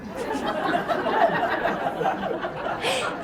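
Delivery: a soft, breathy laugh of disbelief; real laugh. Real, not acted. Disbelieving at the doctor.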